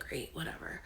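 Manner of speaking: whispered, resigned brush-off. Retold or imitated. Brushed off by her husband, resigned whisper. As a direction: shrug it off under the breath.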